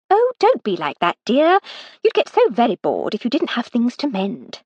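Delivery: jolly her maid out of a sigh; Lady Hardcastle, cajoling. Acted.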